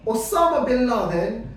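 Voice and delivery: loud bursts on bomb and boom; menacing stranger. Acted. Stranger at bar reacting to Afghanistan, loud and explosive. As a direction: build to a booming punch on the last word. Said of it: "this is what he does".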